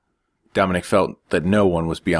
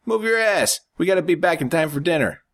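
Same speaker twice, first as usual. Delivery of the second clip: higher, brash urging; pushy younger brother; 12 semitones higher than usual. A narrator's reading. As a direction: hurry your brother along. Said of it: "smacked Dominic on the shoulder".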